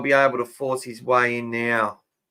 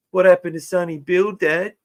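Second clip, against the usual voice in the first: put-on higher, small boy's voice asking; young son's voice; 7 semitones higher, 3 dB louder than usual. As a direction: imitate a little kid questioning his dad. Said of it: "got my son ... to say to me".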